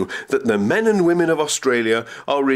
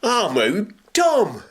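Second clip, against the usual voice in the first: put-on high, slow broad Potteries accent; old villager hailing Joe in a broad Staffordshire accent; broad local accent; 10 semitones higher, slower than usual. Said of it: "greeted him in a broad accent".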